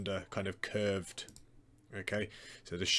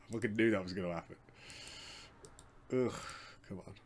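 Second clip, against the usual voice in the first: frustrated groaning; faster than usual. Real, not acted. Video glitch annoyance.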